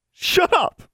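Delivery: very high, loud furious yell; angry shout. Acted. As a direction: scream at her to stop. Mary snaps at Alexandra.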